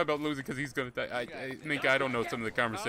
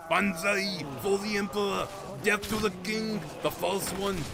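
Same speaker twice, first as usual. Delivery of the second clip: hammed-up Japanese accent, pitched higher; bad Japanese accent; 7 semitones higher than usual. To the group, playing an Imperial Japanese soldier: a hammed-up Japanese accent. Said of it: "me do bad Japanese accents".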